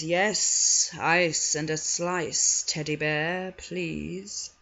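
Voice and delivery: syrupy, drawn-out sleazy lord; creepy Lord Boothby. Retold or imitated. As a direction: savour each word like a lecherous aristocrat. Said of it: "I have to do his voice".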